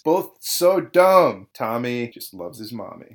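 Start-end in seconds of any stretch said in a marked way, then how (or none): 0.0-1.5 slow, loud, disgusted drag on the words; drawn-out loud mockery. Real, not acted. Podcast host jeers at the dumb brothers, loud and slow. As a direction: stretch out the insult at full volume.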